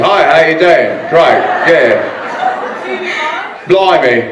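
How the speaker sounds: hammed-up, badly faked Australian; mock Australian accent. Shown as a demonstration. Panelist showing an Australian guest how they talk, mock Australian accent. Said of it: "Australians like this".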